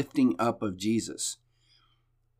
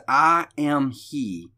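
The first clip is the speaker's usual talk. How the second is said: "I am he" drawn out very slowly, word by word; emphatic; 3 semitones higher, much slower than usual. Acted. Preacher quoting Jesus declaring who he is, emphatic. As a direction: give "I am he" slow, weighty emphasis. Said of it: "he's gonna say it emphatically".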